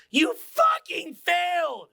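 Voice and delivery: high, drawn-out shout; emphatic shouted verdict. Real, not acted. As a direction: bellow it slowly, stretching every word.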